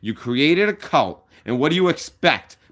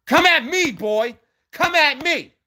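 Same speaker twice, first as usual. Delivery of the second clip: high-pitched angry yell; real heated shouting; furious challenge; 3 dB louder, slower than usual. Real, not acted. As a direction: shout a dare at a rival, voice way up. Challenges rival to fight.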